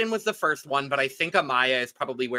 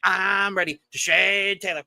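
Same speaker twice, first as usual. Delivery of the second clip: high, eager cartoon sponge voice; squeaky SpongeBob chant, high and bouncy; 5 semitones higher than usual. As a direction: chirp it like an overexcited cartoon sponge. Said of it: "It felt like SpongeBob".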